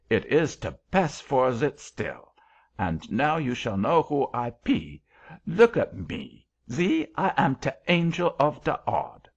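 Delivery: put-on emphatic creature voice, thick German accent; self-important German-accented angel. A narrator's reading. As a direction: introduce yourself pompously in a German accent.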